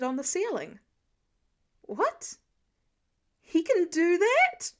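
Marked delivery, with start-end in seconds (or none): 1.8-4.7 astonished. A narrator's reading. Steve stunned, kid on ceiling.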